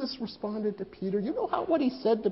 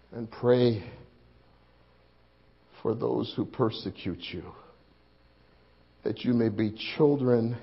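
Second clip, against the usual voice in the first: gentle teaching; 8 semitones lower, 3 dB quieter than usual. Retold or imitated. Quoting Jesus gently.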